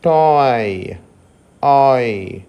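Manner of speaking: "టాయ్" gliding o to i; oi diphthong demo. Shown as a demonstration. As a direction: slide "టాయ్" from o into i. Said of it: "అని పలకవలసి ఉంటుంది". ("టాయ్" ను ఓ నుండి ఇ కి జారుతూ పలకండి)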